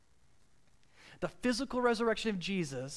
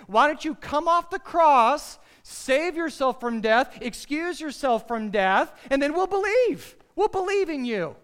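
loud, high jeering taunt; loud mocking crowd taunting Jesus on the cross; 7 semitones higher, 10 dB louder than usual. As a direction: sneer it like a scoffer at the cross. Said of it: "Isn't that what they said mockingly?".

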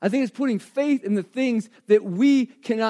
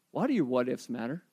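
soft reflective question; 10 semitones lower, 9 dB quieter than usual. Shown as a demonstration. Pastor asking the congregation to reflect, quietly. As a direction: drop the voice low and quiet, inviting thought. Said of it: "pause and ask yourself a question".

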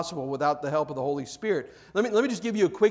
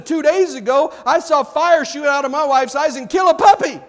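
high, loud, hammed-up objection; protesting husband; 8 semitones higher, 7 dB louder than usual. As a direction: stammer into an exasperated, comically overblown protest. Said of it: "you're saying".

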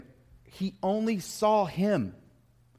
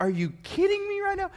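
high, disbelieving squeal; are you kidding me, pitched way up; incredulous. Retold or imitated. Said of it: "And I'm like".

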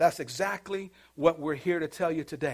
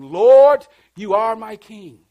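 loud, raised, drawn-out praise; fervent worship cry; 3 semitones higher, 15 dB louder, slower than usual. Acted. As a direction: cry it out in mock worship, stretching the words. Acted worship cry in church.

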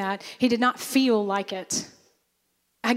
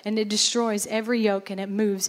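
ordinary talk

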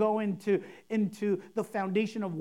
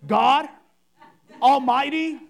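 mock solemn "God Almighty", louder and drawn out; deepened prayer voice; 2 semitones higher, 7 dB louder than usual. Pastor mocking churchgoers who deepen their voice to pray. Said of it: "deepen their voice".

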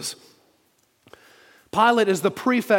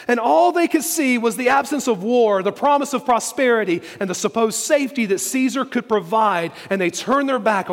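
higher, driving pulpit delivery; impassioned preaching; 5 semitones higher, 3 dB louder than usual. Real, not acted. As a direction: preach it with urgent, rising conviction.

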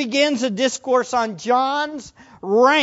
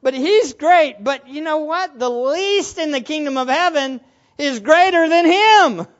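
higher, punchy proclamation; emphatic counterclaim; 4 semitones higher, 3 dB louder than usual. Acted. Preacher paraphrases Jesus recalibrating John's rank, emphatic counterclaim. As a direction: drive the reversal home hard. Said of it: "recalibrates it and says".

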